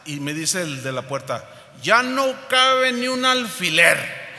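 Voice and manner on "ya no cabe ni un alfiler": higher, louder, drawn-out doorman turning someone away; portero negando la entrada. Retold or imitated. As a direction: play an insistent doorman, loud and high. (portero tajante, voz alta y aguda)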